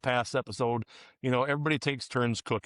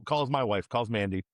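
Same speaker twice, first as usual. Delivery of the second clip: normal talking voice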